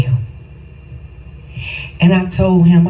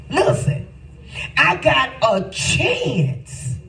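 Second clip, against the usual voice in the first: higher, slow, drawn-out exclaim; excited cry; 7 semitones higher, 5 dB quieter, much slower than usual. Shown as a demonstration. Preacher repeating his own thought, higher and slowed. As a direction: stretch out I got a chance, pitched up. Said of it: "Ooh, I got a chance".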